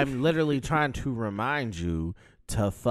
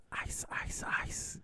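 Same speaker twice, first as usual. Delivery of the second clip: whispering; 11 dB quieter, slower than usual. Real, not acted. Hushed reverence for Bring It On.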